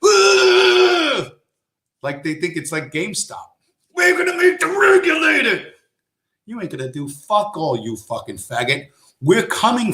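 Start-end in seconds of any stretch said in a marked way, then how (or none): 0.0-1.3 high shrieking mock shout; mock crypto fan. Mocking crypto fans with a shrill battle cry. 3.9-5.7 high shrieking mock shout; mock crypto fan. Mocking crypto fans with a shrill battle cry.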